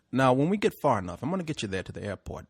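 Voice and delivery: low, quiet Puerto Rican cabbie impression; confiding mock Puerto Rican driver; bad Puerto Rican accent. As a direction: explain the plan softly in a rough Puerto Rican accent. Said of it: "I'm gonna do it bad accent".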